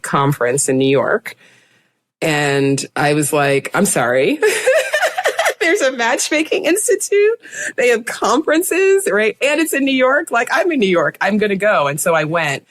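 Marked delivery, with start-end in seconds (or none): 3.7-11.9 thrilled and laughing; giddy excitement. Retold or imitated. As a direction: bubble over with delight at the discovery. Retold excited realization.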